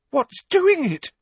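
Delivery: high, slow, frightened squeal; terrified. A narrator's reading. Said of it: "Parvati demanded in a terrified voice".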